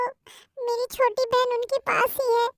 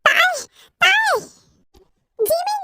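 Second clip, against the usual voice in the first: higher, louder desperate calls; frantic calling; 7 semitones higher, 5 dB louder, much slower than usual. Acted. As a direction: shout her name searching. (बेचैन होकर पुकारना)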